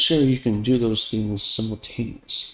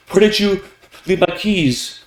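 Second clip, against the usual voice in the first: raised pitch, measured question; mildly aroused pitch; 7 semitones higher than usual. Shown as a demonstration. Speaker asking after lost keys, pitch moderately raised. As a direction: ask it a little higher, not yet urgent. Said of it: "pitch often goes along with pace to indicate arousal".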